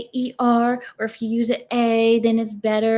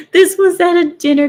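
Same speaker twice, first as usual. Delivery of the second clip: high, loud, half-laughing; laughing confession; 8 semitones higher, 6 dB louder than usual. Real, not acted. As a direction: confess while still cracking up. Laughing confession of past thoughts.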